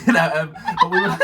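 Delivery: words breaking up into high real laughter; giggling through it until the laugh takes over; real giggles swallowing the words. Real, not acted. Laughing mid-sentence.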